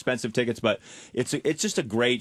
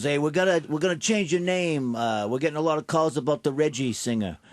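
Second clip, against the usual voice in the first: higher-pitched imitation of a casino heavy; basement guy impression; 5 semitones higher than usual. Casino man announcing a name change, imitated shady caller. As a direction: play the shady man on the phone. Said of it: "like a guy you would think would be calling from the basement".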